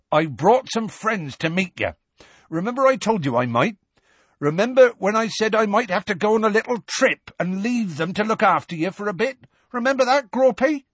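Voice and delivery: high-pitched, insistent calling out; Hagrid shouting. A narrator's reading. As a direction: call up, prodding a giant to remember. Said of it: "shouted Hagrid, looking up apprehensively".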